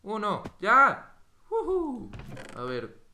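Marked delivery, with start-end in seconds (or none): 0.0-1.1 loud countdown climbing to a high shout; cuenta regresiva gritada. Real, not acted. 1.5-2.1 high, loud whoop; excited whoop. Real, not acted. Whoops after the jump.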